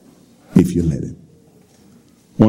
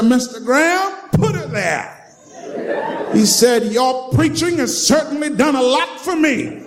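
put-on high tipsy drunk voice; 12 semitones higher than usual. Drunk man greets Graham.

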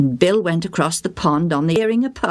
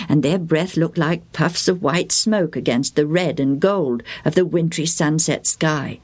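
plain reading voice

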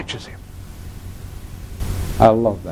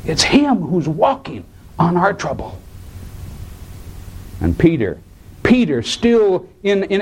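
ordinary talk

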